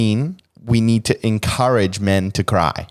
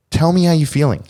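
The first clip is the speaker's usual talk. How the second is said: emphatic; 7 semitones higher, 4 dB louder than usual. Acted.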